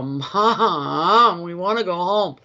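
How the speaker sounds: mock childish complaint, stretched out; whining kids. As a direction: imitate children whining at their mother. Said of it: "my kids would complain".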